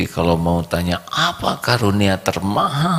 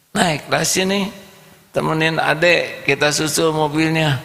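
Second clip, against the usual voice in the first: higher kind mother's voice; gentle mother; 9 semitones higher than usual. Acted. Mother invites the poor boy into her car, lightly voiced higher.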